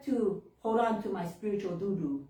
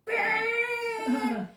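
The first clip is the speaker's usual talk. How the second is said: one long, high mimicked honk; car horn imitation; 13 semitones higher than usual. She passed me and went, long mimicked horn honk. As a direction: hold out a high blaring horn noise.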